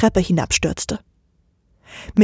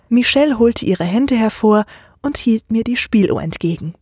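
ordinary delivery, nothing marked

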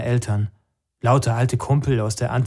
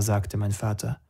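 usual speaking voice

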